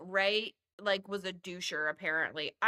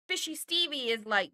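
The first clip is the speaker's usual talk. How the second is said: ordinary talk, nothing marked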